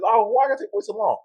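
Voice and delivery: high mock complaining whine; whiny impatient voice. Mimics impatient people.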